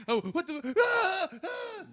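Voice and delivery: high shrieking screams through laughter; laughing mock scream. Mother chasing kids in the dark, shrieking. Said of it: "she's like".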